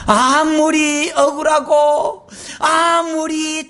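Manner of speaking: very slow, loud preaching; drawn-out emphasis. Acted. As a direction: stretch each word out. (아주 느리고 크게 강조)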